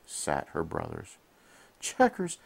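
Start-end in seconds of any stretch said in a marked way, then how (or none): 1.8-2.4 calling out names, much higher and louder; crying out. A narrator's reading. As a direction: call to the lost brothers. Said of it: "she cried".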